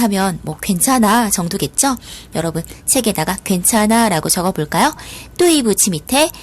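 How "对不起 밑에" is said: ordinary talk, nothing marked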